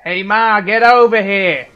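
loud, high New Jersey shout; New Jersey accent. Retold or imitated. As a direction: yell it like a Jersey local calling Ma. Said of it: "That's my best Jersey impression".

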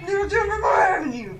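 high, loud laughing protest; panicked squeal. Pretend. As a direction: beg them frantically to stay. Playful panicked shouting.

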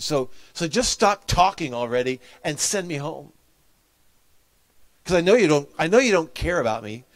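put-on hurt, defiant teen snapping back; angry outburst. Camper's angry outburst at the table.

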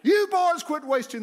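high, loud yell from the door; imitated scolding shout; mother's holler. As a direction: holler at the boys like their mother. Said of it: "and holler".

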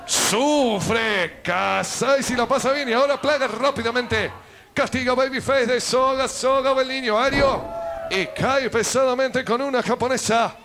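ordinary talk

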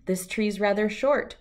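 usual speaking voice

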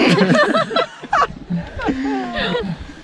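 high nervous burst of laughter. Real, not acted. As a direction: laugh out the nerves. Nervous before bungee jump.

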